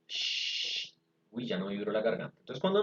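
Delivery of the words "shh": "shh" as a voiceless hiss from the front of the mouth; "shh" with teeth close and no voice; sh sound. Shown as a demonstration. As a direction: hold a long unvoiced "shh", no throat vibration.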